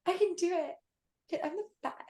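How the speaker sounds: lightly hammed cocky boast; mock sassy self.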